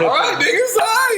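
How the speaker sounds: high, laughing give-in. Real, not acted. Laughing surrender to the group.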